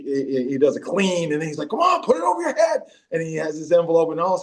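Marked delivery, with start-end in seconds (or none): 1.7-2.8 high-pitched mock coaching yell; urging on. Teammate spotting a pretend envelope lift, high mock urging. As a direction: push him through the lift, voice way up.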